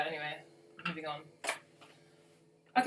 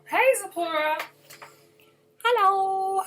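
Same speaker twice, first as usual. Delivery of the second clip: much higher and louder, cheerful hello; calling out a greeting; 11 semitones higher, 9 dB louder, slower than usual. Real, not acted. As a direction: call out to a viewer joining. Greeting a viewer by name.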